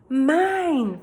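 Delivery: put-on high, loud toddler voice; toddler claiming. A narrator's reading. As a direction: possessive little kid. Toddler Fudge claims book.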